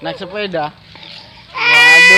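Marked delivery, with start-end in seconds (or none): 1.5-2.2 sudden loud high cry of dismay; surprised exclamation. Real, not acted. Vlogger reacting to the bike mishap, loud surprised cry. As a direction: blurt it out, startled. Hears child fell off bike.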